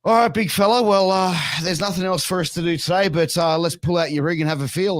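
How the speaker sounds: high, loud, hearty bloke voice; a doctor's matey bark; jovial doctor. Retold or imitated. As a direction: play the cheery blokey doctor. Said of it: "And then he was like".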